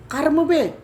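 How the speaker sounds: high, loud repeated retort; bentakan. Retold or imitated. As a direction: throw the challenge back sharply. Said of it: "Terus saya bentak lagi". (membentak menantang, tinggi dan keras)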